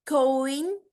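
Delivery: कॉइन drawn out, gliding from "o" up into "i"; gliding diphthong. Shown as a demonstration. Teacher demonstrates two vowels combining, gliding diphthong in कॉइन. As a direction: stretch कॉइन so both vowels are heard, rising. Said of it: "पहले एक से start होती है".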